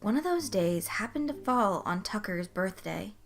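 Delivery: plain reading voice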